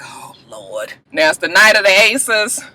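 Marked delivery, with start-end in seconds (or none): 0.0-0.9 low, very soft sigh of words; hushed dismay. Retold or imitated. Jamal reacts after she storms off, hushed dismay. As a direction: drop the voice right down, weary.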